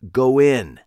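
Pete, commanding. A narrator's reading. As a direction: bark it like a man holding a gun. Pete orders them in.

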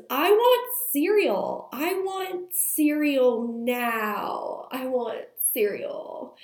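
put-on high, slow whiny toddler demand, stretching each word. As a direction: drag it out like a stubborn two-year-old.

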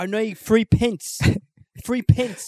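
hammed-up Englishman asking for pence; mock British accent. Cohost joining the British baker parody, mock British accent.

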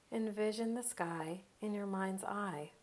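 ordinary delivery

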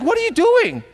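high, loud startled question; surprised. Acted. Disciples seeing the ointment poured, merely surprised. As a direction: blurt it out in high-pitched surprise. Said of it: "surprised, like".

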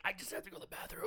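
fast, hushed, unvoiced mumble; embarrassed whisper. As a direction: whisper it fast like you're ashamed to ask. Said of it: "He's like".

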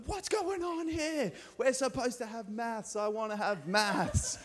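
high, slow, squeaky panicked schoolkid; mock-panicked year seven student protesting; panicked kid. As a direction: play a freaked-out kid who wants maths. Kids freaking out over class.

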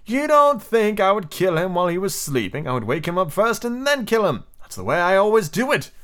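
put-on high, protesting boy's voice; indignant. A narrator's reading. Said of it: "Peter spoke indignantly".

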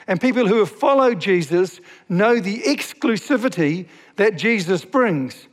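normal talking voice

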